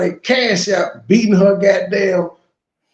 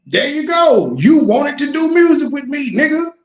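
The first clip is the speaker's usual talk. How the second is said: gloating call-out; 7 semitones higher, 4 dB louder than usual. Real, not acted. Gloating at the clip.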